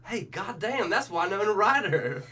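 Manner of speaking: high, loud put-on Southern exclaiming; thinking-at-things voice. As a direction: blurt it in a hammed-up North Carolina voice. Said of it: "that's my thinking at things voice".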